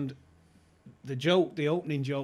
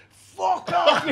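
bellowed high and loud swear at rival fans; retold shout; 11 dB louder than usual. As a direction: belt it out like a furious football fan. Lanks swears at fans again.

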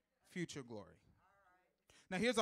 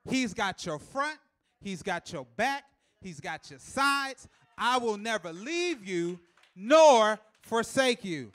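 slow, much louder, hammering each phrase; preaching climax; 4 semitones higher, 12 dB louder, slower than usual. Acted. Preacher at the peak of the sermon, slow loud shout. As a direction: preach it home.

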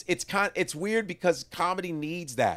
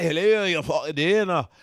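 loud, garbled Scottish; Scottish accent; 6 dB louder than usual. Acted. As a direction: repeat it in impenetrable Scottish. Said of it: "the worst is the Scottish".

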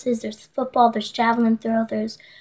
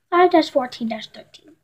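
ordinary delivery

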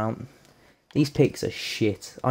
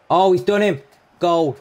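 high, loud burst of delight; excited goal shout; 7 semitones higher, 8 dB louder than usual. Real, not acted. Gamer watching his striker score, shouting with joy. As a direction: yell it as the ball goes in.

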